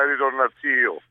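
ordinary talk

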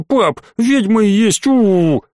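put-on a small boy stretching out his spooky "ведьма"; child insisting. A narrator's reading. Boy calling the inspector a witch to his dad, slow and spooky. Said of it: "повторил".

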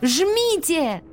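sharp high command; high urgent shout. Acted. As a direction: yell at her to press. Асуми кричит нажать. (резкий высокий крик)

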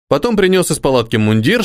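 ordinary delivery

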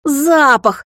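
high, loud exasperated bark; barked roar. A narrator's reading. Vampire snaps back at the puzzled girl, high loud bark. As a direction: bellow the word back in alarm. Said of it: "рявкнул он".

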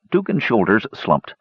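ordinary delivery, nothing marked

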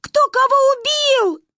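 high, loud frantic cry; panicked. A narrator's reading. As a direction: shout in alarm. Children panicking. (в панике)

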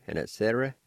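normal talking voice

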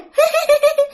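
very high-pitched eerie cackle; high mocking ghost laugh; ghostly cackle. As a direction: cackle shrilly like a possessed woman. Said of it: "Lại cười tiếp".

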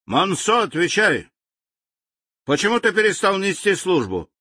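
high, angry interrogation; seething. Acted. General seethes at his colonel, threatening demotion. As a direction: boil over at the colonel. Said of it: "кипел генерал".

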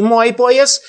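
high, heated snap; hot-tempered retort. A narrator's reading. Old man snapping back about the belt, high and loud. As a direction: fire it back hotly. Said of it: "запальчиво ответил старик".

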